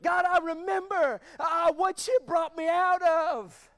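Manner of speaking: loud, slowed preacher's cry; preaching shout. Real, not acted. Preacher crying out to the church, loud and slowed. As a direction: call it out to the church.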